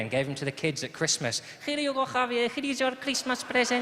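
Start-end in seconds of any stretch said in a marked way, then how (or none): 1.6-3.8 put-on higher Spanish-accented parent to child; Spanish dad handing his son a present, Spanish accent. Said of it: "Perfectly serviceable Spanish accent".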